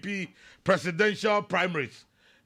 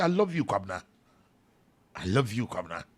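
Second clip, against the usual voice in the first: choked up; 7 semitones lower, faster than usual. Real, not acted. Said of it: "I'm getting emotional".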